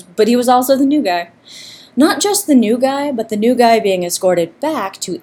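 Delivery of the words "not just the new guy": ordinary delivery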